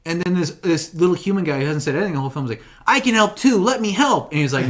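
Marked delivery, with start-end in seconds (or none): 2.9-4.3 high, louder mock kid voice; eager kid. Retelling the film's little human guy offering help, eager. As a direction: imitate an eager little boy, pitched up.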